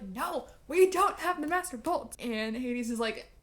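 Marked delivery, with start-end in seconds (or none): 0.0-2.2 higher, louder chorus of denial; everyone in unison. Retold or imitated. The trio denying to Hades all at once, higher and louder. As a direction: protest together like scared kids. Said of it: "everyone's like".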